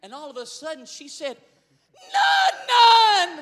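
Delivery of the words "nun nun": put-on shrill high shout, much louder, a toddler wailing for her toy; child's scream. Preacher imitating the little girl screaming for her toy, loud. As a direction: scream the name like a crying child. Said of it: "She is screaming".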